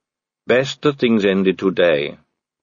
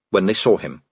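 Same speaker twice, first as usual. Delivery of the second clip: plain reading voice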